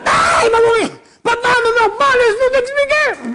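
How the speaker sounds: panicked plea. Acted. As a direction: shout it as if he's collapsing before your eyes. Yehuda fears the father dies.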